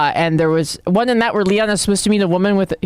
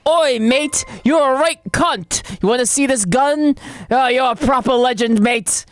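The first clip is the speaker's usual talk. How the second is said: higher, hammed-up British cockney voice; exaggerated British merchant impression; mock British accent; 4 semitones higher than usual. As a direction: lay on the British slang like a parody. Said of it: "They made him so much more British".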